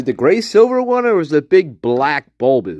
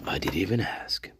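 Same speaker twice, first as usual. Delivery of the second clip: whisper; 11 semitones lower, 13 dB quieter than usual. Acted. Repeats greeting in a whisper.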